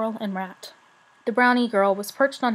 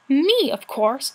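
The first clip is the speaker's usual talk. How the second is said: slow, louder, higher spat retort; 4 semitones higher, 5 dB louder, much slower than usual. A narrator's reading. Brownie girl spits her answer at Rat, slow and louder. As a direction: spit the words out with scorn. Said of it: "Sorrel spat at her".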